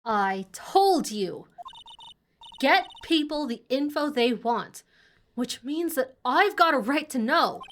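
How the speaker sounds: mild indignant southern twang; lightly voiced southern reporter, insisting; southern accent. Reporter demanding her rights from a detective, mild southern accent. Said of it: "southern accent can only mean, is this Lottie".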